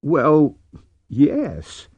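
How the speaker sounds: hesitant, in a man's character voice; stunned admission. A narrator's reading. Ku admits what he means to Drew, stunned. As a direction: admit it haltingly. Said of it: "Ku looked stunned".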